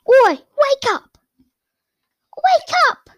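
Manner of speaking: high, loud, slow yell at the snake; bang-on-the-glass brat; shrill demand, pitched very high; bratty shout. Acted. Dudley banging on glass.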